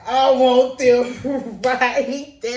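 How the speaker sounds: slow, eager, laughing want; kid pointing at sunglasses. Retold or imitated. Little girl at the register wanting sunglasses, slow and eager. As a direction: stretch it out, begging with a laugh. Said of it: "and I was like".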